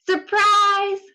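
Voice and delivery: spring it on her with a shout; loud, high gleeful surprise; cried out. Acted. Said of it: "cried the wolf".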